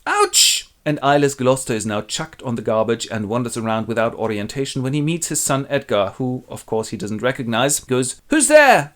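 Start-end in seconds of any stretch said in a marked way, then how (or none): 0.1-0.7 squeaky high ouch of mock pain. Teller wincing at a gouged eye, mock yelp. 8.3-8.9 high, louder calling out into the dark; blind old Gloucester. Acted. Blinded old man calls to a stranger, high and loud.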